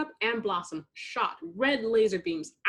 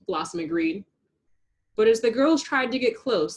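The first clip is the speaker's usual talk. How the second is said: usual speaking voice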